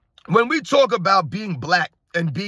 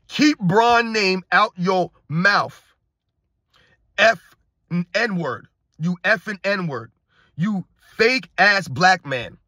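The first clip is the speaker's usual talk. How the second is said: slow, drawn-out reading of an insult; slow mock read-out; much slower than usual. Host reading a fanatic's angry message, slow deliberate mock read-out. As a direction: spell out the message word by word, with heavy pauses. Reads out hostile fan message slowly.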